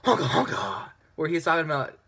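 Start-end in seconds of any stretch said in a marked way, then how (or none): 0.0-0.9 lightly mocking Joker voice, a bit louder; Joker impression. Reviewer mocking the Joker's line, light mock impression. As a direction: parody the line with a sneer. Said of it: "this is exactly how he says it".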